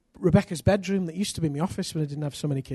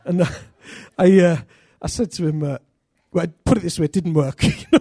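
ordinary delivery

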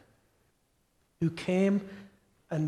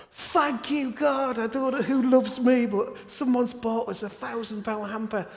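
higher, lightly thrilled prayer of thanks; excited thanks; 6 semitones higher than usual. Retold or imitated. Father thanking God for the hamper, higher and giddy. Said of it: "I thought".

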